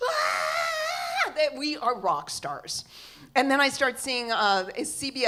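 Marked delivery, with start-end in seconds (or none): 0.1-1.3 high, drawn-out excited scream; high squeal of a thrilled crowd; crowd of screaming kids. Retold or imitated. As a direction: mimic kids screaming for rock stars. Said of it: "they just go".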